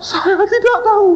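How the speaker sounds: panicked denial. Retold or imitated. Terrified student pleading he killed nobody, high and frantic. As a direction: plead your innocence in a panic.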